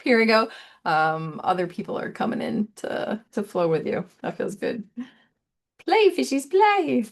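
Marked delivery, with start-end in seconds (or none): 5.8-7.0 playful call. Acted.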